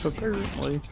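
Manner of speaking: cracking voice mid-word. Real, not acted. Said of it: "what that voice crack in my was".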